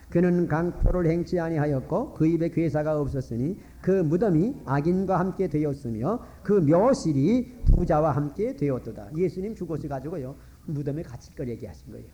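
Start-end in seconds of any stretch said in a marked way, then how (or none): 0.1-9.2 scripture reading aloud. Real, not acted. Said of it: "다 같이 읽겠습니다".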